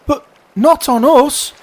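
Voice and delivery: very high, loud protest; crying oysters. Acted. Oysters realising they are the meal, alarmed cry. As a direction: squeal it in alarm. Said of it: "the oysters cried".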